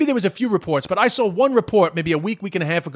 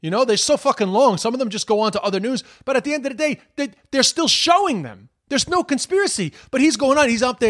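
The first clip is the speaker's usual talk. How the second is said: normal talking voice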